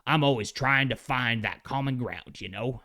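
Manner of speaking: put-on light Southern accent as the Thing; a hint of a Southern accent, homespun. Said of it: "Maybe that's a little too, uh, Southern".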